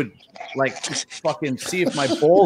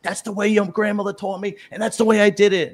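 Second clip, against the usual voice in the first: higher, louder pissed-off mom; mock angry mother; 7 semitones higher, 3 dB louder than usual. Mother snapping at her son, higher and louder. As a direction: bark it like a furious mother. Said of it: "She'd be like".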